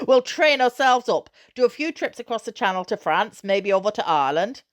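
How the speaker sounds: a father carried away by his dream; breathless excitement. Acted. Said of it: "breathless with excitement".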